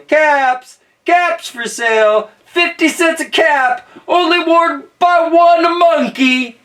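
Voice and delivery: high, loud street-seller cry; calling out wares. Acted. Peddler walks back to town selling caps, calling out. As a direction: call out slowly to the whole town. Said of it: "he walked back to town calling".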